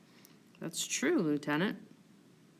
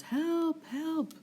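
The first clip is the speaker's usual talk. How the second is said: offstage cry; 9 semitones higher than usual. Acted. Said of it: "A voice off stage calls".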